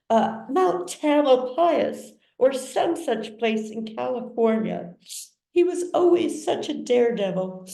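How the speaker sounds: high, tearful society mother; sobbing mother. A narrator's reading. Mother sobbing over her injured son, high and tearful. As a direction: gush it out like a weeping mother. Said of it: "she sobs".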